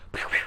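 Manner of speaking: spoken "pew" gunfire noises; imitated gunshots. Rocky shoots at Pip, mimicked gunfire noises. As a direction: mimic bullets whizzing out.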